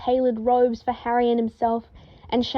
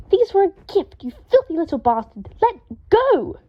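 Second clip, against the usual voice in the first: higher, louder enraged shouts; furious yelling; 7 semitones higher, 5 dB louder than usual. A narrator's reading. Draco yells at the ferret holding his robes. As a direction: yell at the ferret in a rage. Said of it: "Draco screamed".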